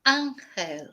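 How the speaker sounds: "ángel" the Spanish way, AHN-hel; Spanish "ángel", open A, stressed first; Spanish pronunciation. Shown as a demonstration. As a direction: say "ángel" with a throaty h for the g. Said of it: "Whereas in Spanish".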